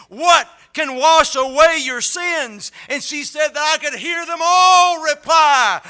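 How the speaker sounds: loud rising preacher's climax; preaching at full force. Acted. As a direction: build to a shouting proclamation.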